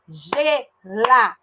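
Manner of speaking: drawn out slowly, syllable by syllable; slow syllable split. Shown as a demonstration. Teacher slowly splitting the word for pupils. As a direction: spell out the word's beats slowly. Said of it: "Ó, geladeira".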